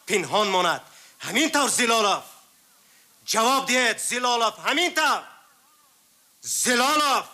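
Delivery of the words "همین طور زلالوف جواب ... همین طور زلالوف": pressing demand. Acted. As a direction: voice climbing high toward a shout. Investigator presses suspect, rising to shout.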